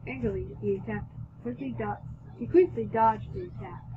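usual speaking voice

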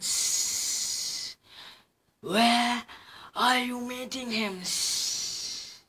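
hissing snake voice. Acted. Snake asks the mouse where. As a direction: hiss the question.

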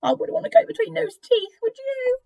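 high-pitched squeamish character voice; high, fretful story-book aside; nervous high voice. Acted. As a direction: pitch it way up, wary of the teeth. Animals react to mouse entering mouth.

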